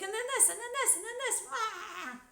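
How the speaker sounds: high, frantic rapid-fire voice; ADHD madness. Pretend. Streamer mocks her own scattered podcast rambling, high and frantic. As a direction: gush like your brain won't stop. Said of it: "ADHD madness".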